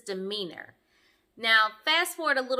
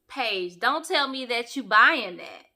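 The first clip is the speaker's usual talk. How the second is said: normal talking voice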